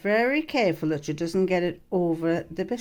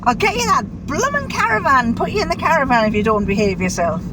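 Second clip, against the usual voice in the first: mock threat; 6 semitones higher, 5 dB louder, faster than usual. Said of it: "I'll say".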